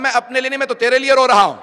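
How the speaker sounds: "मैं अपने लिए नहीं" cried out high and loud, full preaching force; impassioned loud preaching. Acted. Bahlul answers the caliph, crying out at full preaching force. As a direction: belt "मैं" from the pulpit, rising into a wail.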